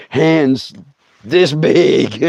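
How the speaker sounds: usual speaking voice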